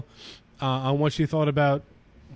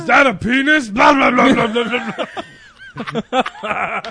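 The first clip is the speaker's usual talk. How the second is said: loud, high cartoon shout; hammed-up monster gibberish; silly character voice; 6 semitones higher, 7 dB louder than usual. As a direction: babble it out at full volume.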